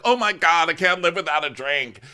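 mock-dramatic craving for a drink; mock desperate. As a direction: overplay the craving. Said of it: "everybody tells you where it's like".

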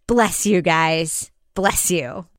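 slow, hushed solemn blessing; hushed deference. Pretend. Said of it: "Did I sound reverent enough?".

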